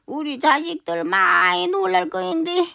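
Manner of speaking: a hammed-up last request; faint mock farewell. Grandma's fading mock farewell. (힘없이 부탁하는 할머니)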